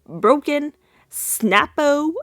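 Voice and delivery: lightly hammed-up comic reply; mock blunt verdict. Retells Cliff's blunt joking answer.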